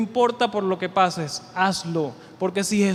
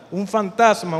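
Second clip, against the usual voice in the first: louder mock cry of fright; retold frightened cry; 6 dB louder, slower than usual. Disciples to the sea figure, louder mock frightened cry. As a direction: shout it like scared disciples seeing a ghost. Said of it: "Llenos de miedo gritaron".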